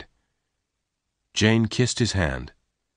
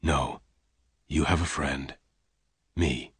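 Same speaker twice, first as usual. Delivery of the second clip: lower, soft gentle reassurance; gently; 5 semitones lower, 7 dB quieter than usual. Acted. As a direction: comfort her tenderly. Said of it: "Lassiter said gently".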